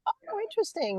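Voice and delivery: a light, airy retold oh-interesting; mildly intrigued. Retelling to the host how she reacted to hearing about the program, mildly intrigued.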